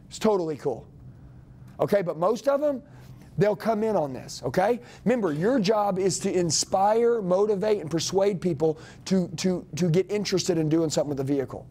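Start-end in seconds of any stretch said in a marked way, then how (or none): none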